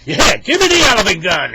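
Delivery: very loud, high, fired-up demand; fierce eagerness. Acted. Henry jumps at the elephant gun offer, fiercely eager. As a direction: yell it, raring for revenge.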